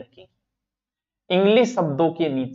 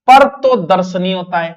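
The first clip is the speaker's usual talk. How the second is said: पर तो दर्शनी said louder, with the word पर hit hard; stressed word; 8 dB louder than usual. Shown as a demonstration. Teacher drilling students on the spelling प्रदर्शनी, with stress. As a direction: punch पर and say दर्शनी clearly. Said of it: "प्रिय दर्शिनी".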